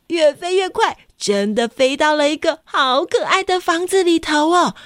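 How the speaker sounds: high, bright storybook voice; sing-song read-aloud for little kids; reading to a toddler. Acted. Reads picture book to children.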